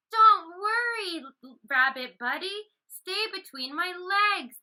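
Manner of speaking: elephant reassuring the rabbit hiding under him. Acted. Elephant comforting rabbit in story.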